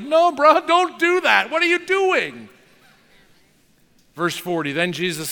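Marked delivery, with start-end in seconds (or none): 0.0-2.5 high, loud mock panic; alarmed crowd protest. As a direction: yell at him to stop, bro-style. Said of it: "everyone's like".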